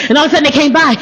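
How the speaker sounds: ordinary delivery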